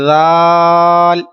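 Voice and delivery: जाल stretched very long and even; teacher modelling long जाल; drawn-out recitation. Shown as a demonstration. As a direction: hold जाल for three seconds. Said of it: "repeat कीजिए तीन मरतबा".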